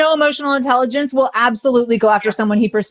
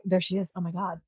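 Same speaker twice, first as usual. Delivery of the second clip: soft, breathy-free awed murmur, much softer; imitating starstruck fans murmuring; hushed awe; 7 semitones lower, 13 dB quieter than usual. Fans spotting her in the lobby, hushed awe.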